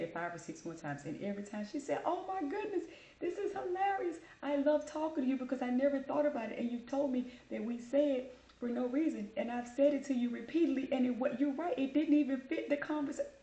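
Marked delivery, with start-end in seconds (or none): 2.0-13.3 high, gushing agent impression; imitated phone agent. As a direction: mimic her delighted realization, pitched high. Voicing the amused phone agent.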